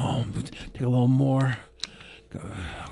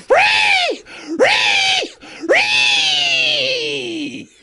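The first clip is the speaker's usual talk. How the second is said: high drawn-out screams; screaming; much slower than usual. Pretend. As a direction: scream the word out, stretching it. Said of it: "gonna give you a good promo".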